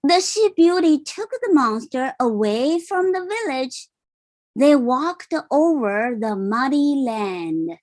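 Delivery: plain reading voice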